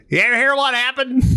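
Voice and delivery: high, quick, slurred-together character voice; Carl. Retold or imitated. Correcting himself on how his friend talks, high and quick. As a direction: imitate an Oklahoman buddy, running words together. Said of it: "No, Carl talks".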